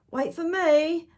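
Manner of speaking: high, loud plea to wait; child calling. Acted. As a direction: call after friends, don't be left behind.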